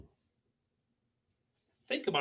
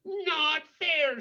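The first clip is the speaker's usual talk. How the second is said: hare's shout; 11 semitones higher than usual. Acted. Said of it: "shouted Hare".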